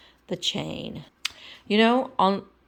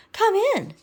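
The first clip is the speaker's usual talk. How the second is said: Miss Piggy voice; 5 dB louder than usual. Acted.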